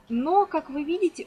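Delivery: usual speaking voice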